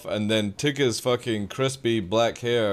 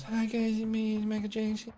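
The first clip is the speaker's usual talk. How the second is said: high, soft breathy-free falsetto impression; mock the famous soft high voice; 11 semitones higher, 6 dB quieter than usual. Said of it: "he never actually spoke like that".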